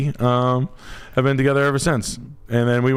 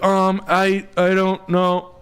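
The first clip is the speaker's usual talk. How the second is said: hesitant wife; 9 semitones higher, much slower than usual. Retold or imitated. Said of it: "She was like".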